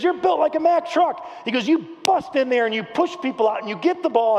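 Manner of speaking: high, punchy pep talk; hyped coach voice, driving the words home; retold fired-up coach. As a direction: imitate a coach revving up a player. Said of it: "he was going all fired up".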